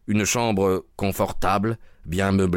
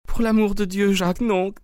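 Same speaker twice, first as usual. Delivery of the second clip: crying out; 13 semitones higher than usual. Acted. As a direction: throw yourself in his way with a high cry. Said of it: "cria-t-elle".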